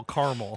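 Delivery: caramel said as KAR-mel, two syllables; caramel clipped to KAR-mul. Shown as a demonstration. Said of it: "I don't like when people pronounce caramel caramel".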